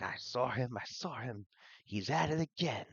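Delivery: put-on high, eager kid voice; excited kids. Game master as kids spreading a rumour, excited.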